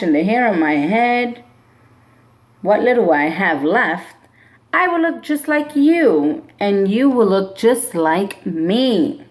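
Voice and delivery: normal talking voice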